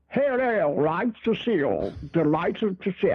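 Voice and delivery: mock plummy English greeting; posh British accent. Imitating how an eloquent Brit greets you, in a posh British accent. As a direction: lightly send up a polished Brit. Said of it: "and you speak like that".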